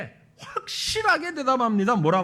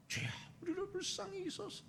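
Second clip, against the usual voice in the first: hushed plea; 8 semitones higher, 18 dB quieter than usual. Acted. Softly voiced plea.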